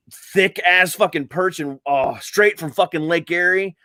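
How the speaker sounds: usual speaking voice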